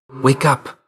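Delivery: loud, high urgent call. Acted. Calling to a fallen Zag to rouse, urgent.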